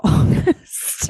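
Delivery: laughing. Real, not acted.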